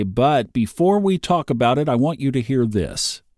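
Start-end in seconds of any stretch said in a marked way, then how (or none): none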